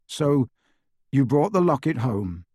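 mildly insistent questioning; relentless Harry. A narrator's reading. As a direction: press on for the full story. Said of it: "he said relentlessly".

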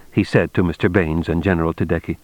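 ordinary delivery

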